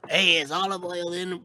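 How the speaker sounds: a hint of a cartoon sailor calling at the door; acted-out cartoon greeting; lightly voiced Popeye. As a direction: call at the door in a light character voice.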